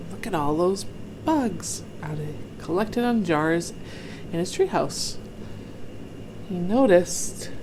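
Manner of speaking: ordinary delivery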